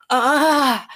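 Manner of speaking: high, very loud cry of orgasm; climax cry. Acted. Climax during the story.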